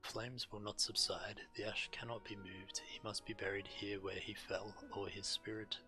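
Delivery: ordinary delivery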